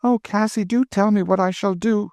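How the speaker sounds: put-on high, anxious girlish pleading; pleading young girl. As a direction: beg her to run away with you. Emmeline pleads.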